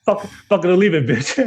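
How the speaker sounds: playful fake rage at a guest; mock yell. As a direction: bark it out as a teasing joke. Host jokingly mocks the guest.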